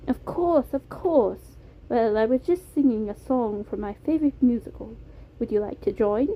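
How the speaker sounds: put-on oblige keenly in a British accent; Beam, British accent. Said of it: "It was a soft British accent".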